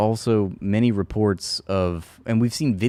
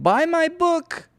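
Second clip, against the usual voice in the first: squeaky, much higher, slow mocking shout; mock Dershowitz; slower than usual. Mocking Dershowitz hawking his book to a jeering crowd, squeaky high. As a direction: squeal it like a desperate salesman. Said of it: "And he's like".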